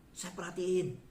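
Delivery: ordinary delivery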